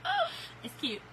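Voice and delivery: very high delighted coo. Real, not acted. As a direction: gush over something cute. Reacting to a cute gift.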